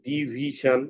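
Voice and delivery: "ডিভিশন" slowly stretched into three syllables, di-vi-shon. Shown as a demonstration. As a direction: draw out each syllable of "ডিভিশন" for counting. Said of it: "এখানে কয়টা syllable আছে?". ("ডিভিশন" তিন সিলেবালে ভেঙে টেনে ধীরে বলা)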